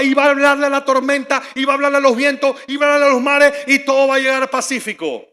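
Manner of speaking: high, very loud church victory shout; mock revival-style proclamation, loud and high throughout; imitated fervent shouting. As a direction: shout it out like an ecstatic believer, sustained at full force. Acting out a fervent congregation's victory shout.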